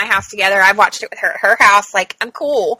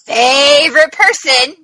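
drawn-out, louder mock praise; sarcastic; 3 semitones higher, 4 dB louder, slower than usual. Real, not acted. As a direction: stretch it out with heavy sarcasm. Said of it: "I say that sarcastically".

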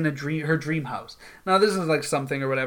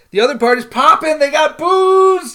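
raised voice, stretching out "booze"; excited hype; 9 semitones higher, 7 dB louder than usual. Real, not acted. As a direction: hype the party like a rowdy guest. Hyping up the livelier party.

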